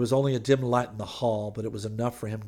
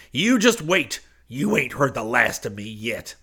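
trembling threat; 3 semitones higher, 6 dB louder than usual. A narrator's reading. Preacher leaving Hayes with a warning, trembling threat. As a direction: throw a parting threat.

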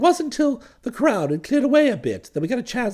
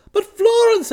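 high pleading cry; 10 semitones higher, 5 dB louder, slower than usual. Acted. Bertie pleads.

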